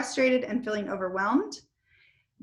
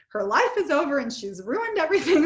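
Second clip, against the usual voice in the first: put-on higher, louder and quick, hamming up a child's despair; mock child drama; 5 semitones higher, 4 dB louder, faster than usual. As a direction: play the daughter's overblown wail in fun. Mocking the upset child's outburst.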